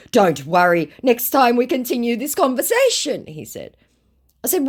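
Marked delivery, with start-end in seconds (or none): 0.1-3.3 high, loud mock reassurance; scammer's put-on voice. Acts scammer's reply.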